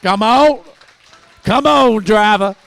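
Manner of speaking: shouted, high call across the room; loud call out. Real, not acted. Comic calling out to the driver, loud shout. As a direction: yell it to get the driver moving.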